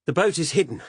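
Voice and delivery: loud, high call; shout. Acted. Narrator bluffing to the cops about the boat, shout. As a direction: yell out the lie to keep them going. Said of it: "I shouted".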